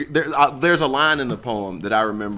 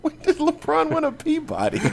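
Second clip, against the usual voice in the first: incredulous. Real, not acted. Incredulous at LeBron claim.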